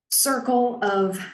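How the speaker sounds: normal talking voice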